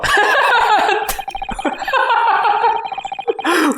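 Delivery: long, high, breathless laughing fit; real uncontrolled high laughter; helpless laughter. Real, not acted. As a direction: crack up at your own silly accent. Cracks up at own accent.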